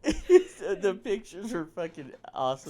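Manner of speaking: slow laughing words; real laughter. Real, not acted. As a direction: laugh while you say it. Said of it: "both laughing".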